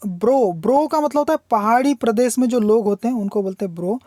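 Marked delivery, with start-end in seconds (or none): none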